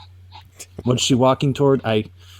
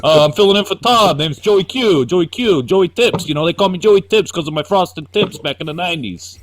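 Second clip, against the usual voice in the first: higher, louder, brash salesman voice; a cocky toy-company host welcoming you in; doing Joe Quesada as a sleazy showman; Joe Quesada impression; 6 semitones higher, 4 dB louder than usual. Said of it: "That was my Joe Quesada impression".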